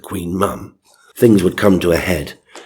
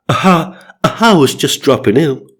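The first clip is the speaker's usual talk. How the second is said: hiccuping singer impression, higher; hammed-up rock-and-roll catch; 8 semitones higher, 5 dB louder than usual. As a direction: hiccup at the start in a high put-on voice. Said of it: "Bunny said".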